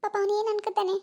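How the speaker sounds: ordinary talk, nothing marked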